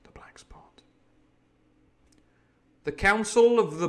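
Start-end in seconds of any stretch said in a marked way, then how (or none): 0.0-0.8 breathy whisper of the chapter title. A narrator's reading. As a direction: whisper it like a dark omen.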